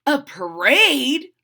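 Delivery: loud, high, drawn-out question; excited surprise. A narrator's reading. Freddie hearing about the costume parade, excitedly surprised. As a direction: ask it in delighted disbelief.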